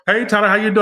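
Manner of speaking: high, loud cheery fan greeting; imitating a fan. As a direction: call out like an eager fan in public. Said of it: "They're gonna go".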